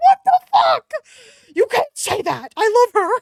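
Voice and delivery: very high, loud, indignant yell; outraged shout. Real, not acted. Reader protesting a betrayal in the story.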